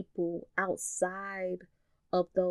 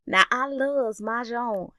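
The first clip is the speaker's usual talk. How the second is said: put-on country southern accent; 6 semitones higher, 4 dB louder than usual. Southern auntie declaring love for her husband, country southern accent. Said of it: "in her country southern accent".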